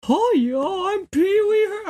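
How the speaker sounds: very high, loud cartoonish squeal; badly faked falsetto character voice, belted out; Pee-wee Herman. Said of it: "that's not a Pee-wee voice".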